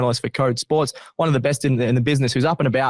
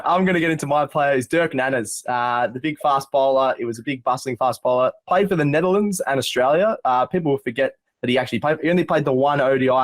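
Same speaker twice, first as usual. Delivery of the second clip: ordinary delivery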